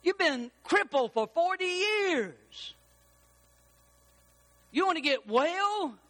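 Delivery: high, drawn-out incredulous question; mock disbelief. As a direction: stretch it out in exaggerated amazement.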